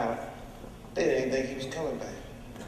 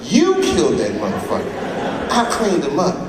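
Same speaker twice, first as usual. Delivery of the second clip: Iceberg snapping at her; 4 semitones higher, 7 dB louder than usual. Acted.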